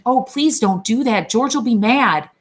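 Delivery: high, loud panicked plea; frightened begging. A narrator's reading. Lennie begs Curly's wife in fright, high and loud. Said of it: "he begged".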